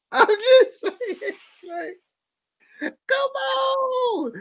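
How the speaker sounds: high, slow, squeaky come on; high-pitched exasperated plea; exasperated squeal. Real, not acted. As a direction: cry out in mock disbelief.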